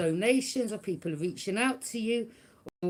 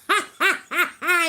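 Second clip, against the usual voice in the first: mocking laugh; 8 semitones higher, 8 dB louder than usual. Real, not acted. Laughing at the haters.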